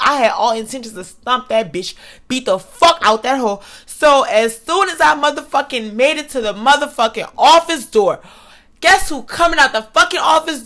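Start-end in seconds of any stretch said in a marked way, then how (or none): none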